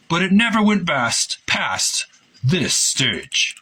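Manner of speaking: a hint of the designer reminiscing; quoted engineer. Reading Hector's quoted recollection.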